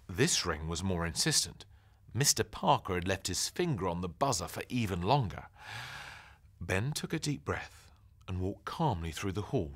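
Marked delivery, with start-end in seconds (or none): none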